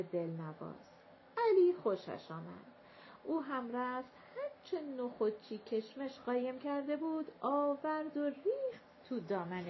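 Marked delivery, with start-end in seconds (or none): none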